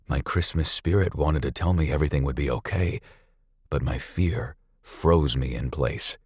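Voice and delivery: ordinary delivery, nothing marked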